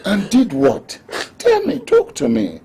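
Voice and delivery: high, urgent demand to be told; anxious, urgent questioning; urgent pleading. Acted. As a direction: press her to speak, pitch climbing. Master urges upset servant to speak.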